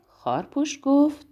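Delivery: ordinary delivery, nothing marked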